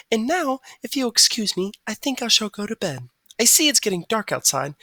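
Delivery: a high, courteous bird voice; dainty and well-mannered; polite songbird. A narrator's reading. Margalo saying good night to Stuart, high and polite.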